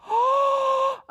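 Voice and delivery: big high-pitched gasp; amazed gasp. Pretend. Reacting to the egg's contents.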